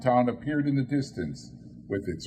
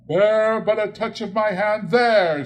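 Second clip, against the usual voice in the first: ghost voice; 10 semitones higher, 7 dB louder than usual. Acted. Ghost reassures Scrooge.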